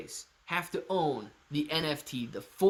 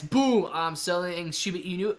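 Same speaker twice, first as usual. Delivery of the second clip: ordinary talk, nothing marked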